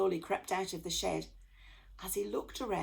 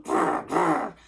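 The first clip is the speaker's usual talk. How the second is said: loud rasping cat growl; 10 dB louder than usual. A narrator's reading. Secret Service cats growling at the men, loud. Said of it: "growled the Secret Service cats".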